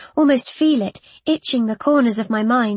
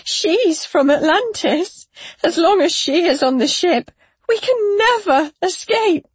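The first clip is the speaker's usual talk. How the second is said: anguished howl; 7 semitones higher, 4 dB louder than usual. A narrator's reading. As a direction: howl it like an injured animal. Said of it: "like the howl of an injured animal".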